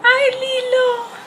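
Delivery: high, slow sing-song greeting to a pet; high pet-talk voice. Real, not acted. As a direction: call out sweetly to the pet. Greeting her pet in background.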